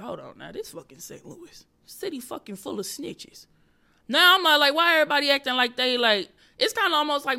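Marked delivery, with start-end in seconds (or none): 0.0-3.5 hushed aside, softer; whispering to herself about the crowd; whispered thought. Retold or imitated. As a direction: mutter it like a private realization. Inner thought on stage.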